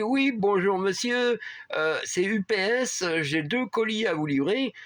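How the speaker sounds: a hint of a Spanish accent, high and slow; lightly put-on Spanish-accented delivery man. Delivery man phoning about two parcels, light Spanish accent. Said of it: "avec un fort accent espagnol".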